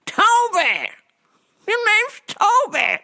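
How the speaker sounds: high, shouted Toby; mock master yell. Mock slave master insisting on Toby, high yell. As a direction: bark the name Toby way up high. Acting out Roots scene.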